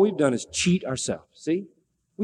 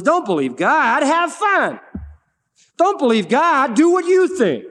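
high, loud coaxing devil voice; tempting Satan; 8 semitones higher, 4 dB louder, slower than usual. Retold or imitated. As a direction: lure them away from God, loudly. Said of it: "Satan comes along and says".

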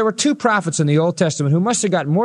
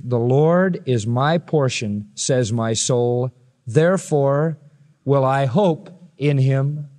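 slow reverent reading; 4 semitones lower, much slower than usual. Shown as a demonstration. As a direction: read it slowly, reverently. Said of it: "he says in the next verse".

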